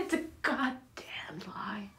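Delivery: slow, venomous whisper; bitter whisper. Acted. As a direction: spit it out under the breath, slowly. Bitter outburst against love stories.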